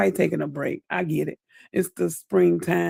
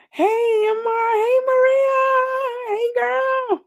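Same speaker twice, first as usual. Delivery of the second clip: high squealed hellos; squealing greeting. Real, not acted. Calling out to Maria joining the live, high excited squeal. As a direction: squeal hello to friends who just showed up.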